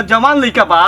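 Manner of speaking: ordinary talk, nothing marked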